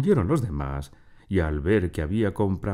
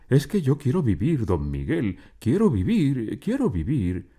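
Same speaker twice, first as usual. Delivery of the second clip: pleading for life; 7 semitones higher than usual. A narrator's reading. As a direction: beg desperately to live. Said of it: "suplicante y de miedo tembloroso". (súplica temerosa, voz alzada)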